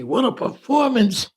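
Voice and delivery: high-pitched congratulating relative; gushing praise. Retold or imitated. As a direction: gush over the young actor. Relatives gush over him backstage.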